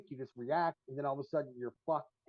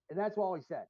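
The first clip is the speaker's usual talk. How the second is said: ordinary delivery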